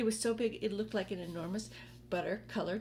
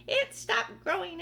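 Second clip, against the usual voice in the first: high, slow, loud cry; excited cry; 11 semitones higher, 7 dB louder than usual. Acted. Aunt Spiker as the peach stops, high slow loud cry. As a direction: cry it out triumphantly. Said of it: "Aunt Spiker cried".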